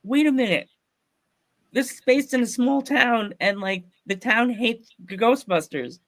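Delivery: high-pitched dawning disbelief; dawning realization. Retold or imitated. Fan watching trailer spots his own story, high astonishment. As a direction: re-enact piecing it together, high.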